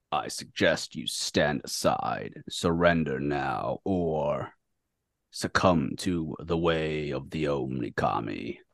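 very low, slow, soft character voice; solemn warning. Acted. Taraki warns Brother Faust to surrender, low slow menace. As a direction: drop it deep and threaten quietly. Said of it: "Taraki looks at Brother Faust".

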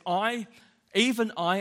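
God's voice leaning hard on I, even I; emphatic I. Acted. Preacher voicing God, stressing I, even I. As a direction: press each I with divine weight. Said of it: "God says".

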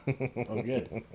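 ordinary delivery

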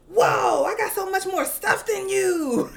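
high, loud bragging squeal; little girl bragging to her brother, loud and excited. Retold or imitated. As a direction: gloat over the bigger haul. Daughter opens her bag.

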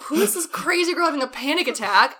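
high, loud mock astonishment; incredulous. Guys react to girl pulled into wrong room, mock incredulous. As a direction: imitate gawkers baffled by the intruder.

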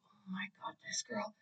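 very soft, whispered aside in mock exasperation; barely audible retold grumble; exasperated mutter. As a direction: mutter it under your breath, fed up. Muttered reaction to yelling friend.

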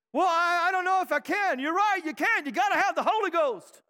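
high, rousing Southern preacher cadence. Acted. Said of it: "didn't I sound like a Southern preacher right there".

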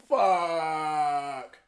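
sudden dismayed curse; caught. Retold or imitated. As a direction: spit it out like you just got caught. Caught in closet.

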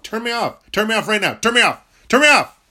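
furious, escalating demand to be switched off; shouted dare at the listener; angry shout. Real, not acted. Ranting outburst, dares listener to switch off.

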